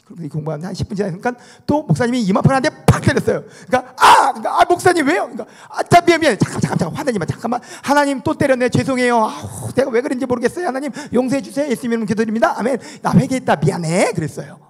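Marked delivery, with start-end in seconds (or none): 4.0-4.3 high, very loud mock cry of pain; startled yelp. Child slapped again, acted. 4.6-5.2 high, loud whine of complaint; mock wounded indignation; child's upset protest. Child protests second slap. 5.7-14.1 high-pitched, flustered string of apologies; hammed-up sorry rolled into a token prayer; mock apologetic prayer. Pastor's repeated mock apology and prayer.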